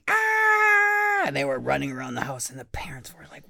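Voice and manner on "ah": high, loud mock scream; kids' scream. Kids screaming at their zombie parents, hammed-up shriek. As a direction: let out a big comic shriek. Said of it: "they all started screaming".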